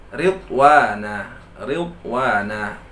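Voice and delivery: Ridwana drawn out slowly with a thin ra. Shown as a demonstration. Teacher models Ridwana for students, slow thin ra. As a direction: recite Ridwana slowly, light r. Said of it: "Dibaca ra tersebut dengan tipis".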